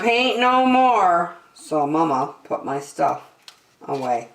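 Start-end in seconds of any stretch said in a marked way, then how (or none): none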